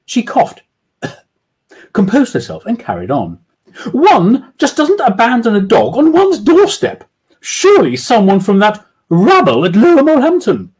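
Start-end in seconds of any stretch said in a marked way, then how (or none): 3.9-10.7 haughty falsetto lady. Acted. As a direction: look down your nose at them. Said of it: "she added haughtily".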